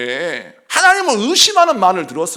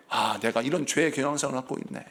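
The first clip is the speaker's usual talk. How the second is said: quiet inner confession; 6 semitones lower, 9 dB quieter than usual. Retold or imitated. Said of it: "inner realization quote".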